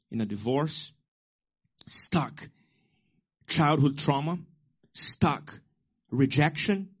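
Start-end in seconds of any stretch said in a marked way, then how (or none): 1.9-2.5 breathy whisper on one word. Acted. Pastor whispers to congregation, hushed whisper. Pastor lists what holds people. 4.9-5.6 whisper. Acted. Pastor lists what holds people.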